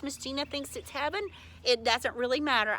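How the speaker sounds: ordinary talk, nothing marked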